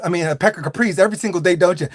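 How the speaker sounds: ordinary talk, nothing marked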